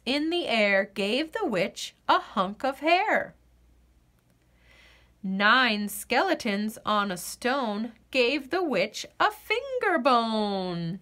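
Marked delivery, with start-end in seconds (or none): none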